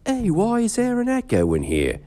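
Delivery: ordinary delivery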